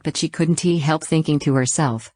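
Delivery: ordinary delivery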